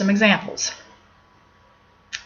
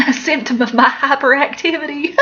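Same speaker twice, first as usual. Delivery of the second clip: laughing through the words, pitched up; 5 semitones higher than usual. Real, not acted. Teacher laughing at her own quirk to students, laughing. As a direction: chuckle it out at yourself.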